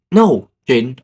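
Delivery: loud, emphatic mock protest; firm refusal. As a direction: put your foot down, loudly. Refusing the bet.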